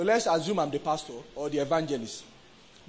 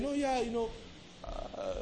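put-on softer, affected London-returnee accent; mock the worn-on accent with hesitant fillers; put-on foreign accent; 6 semitones higher, 8 dB quieter than usual. Preacher mocking people back from two weeks in London, put-on accent. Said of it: "we come back and wear the accent".

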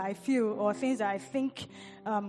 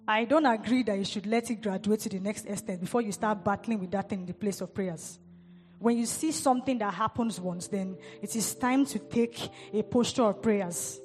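usual speaking voice

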